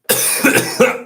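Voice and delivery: sharp dry cough from a cold; cough, then catch your breath. Real, not acted.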